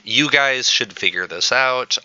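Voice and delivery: usual speaking voice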